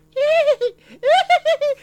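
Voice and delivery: put-on very high eerie giggle of ghost children; shrill haunted-ride kid laughter; high spooky children's giggle; creepy child laugh. Children laughing in dark tunnel.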